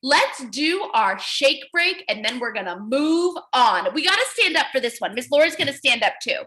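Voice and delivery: ordinary talk, nothing marked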